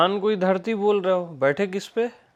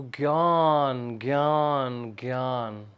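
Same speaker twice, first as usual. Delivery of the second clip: slow fading repetition; 5 semitones lower, 4 dB quieter, much slower than usual. Shown as a demonstration. Teacher dwelling on one word.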